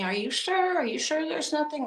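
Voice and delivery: high, prodding imitation of the husband; husband poking. Husband probing his wife on the phone, high and prodding. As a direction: needle her, all fake concern. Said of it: "you know, poking at me".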